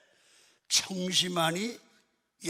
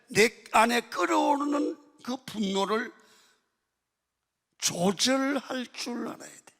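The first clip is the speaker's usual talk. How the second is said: raised, emphatic preacher's voice; forceful preaching; 5 semitones higher, 5 dB louder than usual. Real, not acted. Pastor urging congregation to control anger, forceful preaching. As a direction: drive the point home at raised volume.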